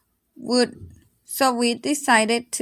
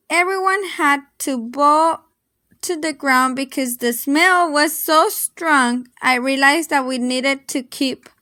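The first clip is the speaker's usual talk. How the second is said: usual speaking voice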